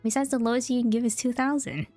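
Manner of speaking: normal talking voice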